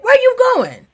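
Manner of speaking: high-pitched call of a pestering man; imitated nosy stranger. Parking lot stranger calls to a passerby, high and nosy. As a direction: mimic a man calling after someone.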